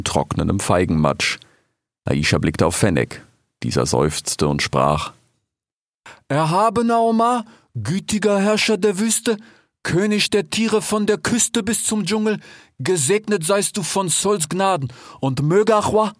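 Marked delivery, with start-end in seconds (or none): 6.3-16.1 high, ceremonious flattery; bow and flatter the king solemnly; formal court address. Acted. Fennec reluctantly addressing King Omar with grand titles.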